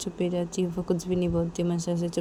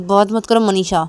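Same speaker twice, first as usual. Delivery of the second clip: loud, firm dismissal; angry rebuff; 10 dB louder than usual. Acted. Nisha telling Manisha not to speak to her, angry. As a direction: push the other away with a raised voice.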